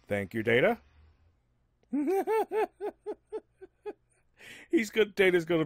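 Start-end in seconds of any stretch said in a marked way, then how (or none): 1.9-3.4 high laugh. Real, not acted. Laughs after joke.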